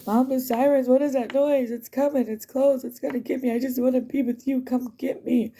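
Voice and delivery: put-on high whiny kid asking for mom; scared little daughter. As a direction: beg like a frightened little girl. Voicing scared daughter again.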